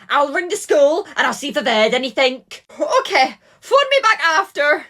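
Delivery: very high, anxious grandmother voice; a nan panicking about her missing granddaughter; panicked nan. Acted. Nan phoning Kelly about Emma, high and panicked.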